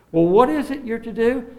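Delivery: usual speaking voice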